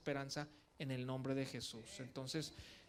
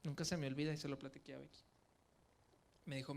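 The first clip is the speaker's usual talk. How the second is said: ordinary talk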